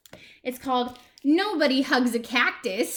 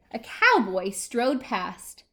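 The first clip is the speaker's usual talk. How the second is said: ordinary delivery, nothing marked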